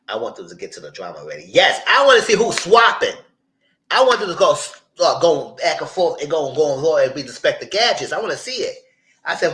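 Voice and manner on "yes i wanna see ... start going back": high, loud eager shout; excited shout. Real, not acted. Viewer itching for the show's drama, excited shout. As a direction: shout it out, can't wait.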